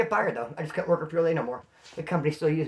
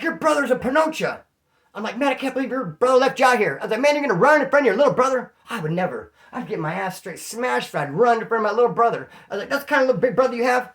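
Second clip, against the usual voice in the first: taunting; 6 semitones higher, 5 dB louder than usual. Retold or imitated. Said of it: "And I'm like".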